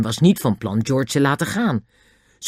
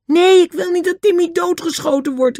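very high, loud panicked cry; terrified cry; 5 dB louder than usual. A narrator's reading. George fears Timmy will be shot, terrified. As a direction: cry out in sheer fright for the dog. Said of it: "riep George doodbang".

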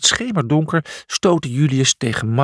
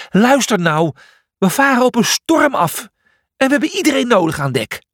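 high, pressing plea; raised-pitch alarm, hurrying at the end; urgent warning; 10 semitones higher, 3 dB louder than usual. Acted. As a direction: urge everyone on deck.

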